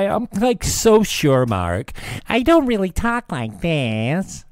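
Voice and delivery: high, loud crybaby whine; whiny Stelter mockery. Mocking Brian Stelter crying about critics, high loud whine. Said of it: "that's all they do is cry about".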